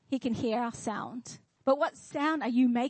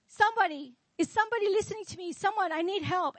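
high, urgent mock plea; acted desperate call for help; imitated crying out; 6 semitones higher, faster than usual. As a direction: plead for someone to listen, pitched up. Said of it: "he was crying out".

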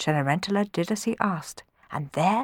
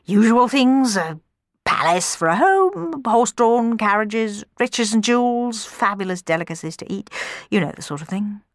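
hedging baron; 4 semitones higher than usual. A narrator's reading. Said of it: "hedged Don Magnifico".

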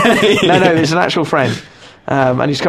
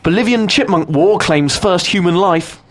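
news headline read; 3 semitones higher, slower than usual. Pretend. Reading mock news headline.

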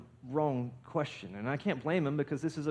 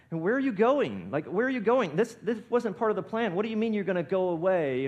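high, loud, urgent questioning; raised voice, imitating confused protest; bewildered protest; 6 semitones higher, 6 dB louder than usual. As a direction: act out alarmed followers demanding an answer. Acting out bewildered disciples' questions.

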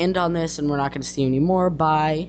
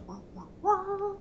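sad trombone wah; 8 dB quieter than usual. Pretend.

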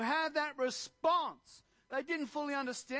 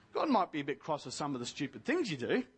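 hurried self-correction after an apology; 8 semitones lower, faster than usual. Real, not acted. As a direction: lower and fast, rushing the fix. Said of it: "sorry, forgive me, dear Jesus".

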